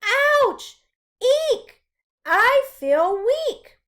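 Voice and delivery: high, loud yelps, then slow and weak; pained yelps. Acted. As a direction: cry out as the ant bites. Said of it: "bit him again and again".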